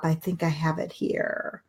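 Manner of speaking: a near-silent whisper to herself; whispered aside. Real, not acted. Host looking for her website address, whispering to herself. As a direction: mutter under breath while searching.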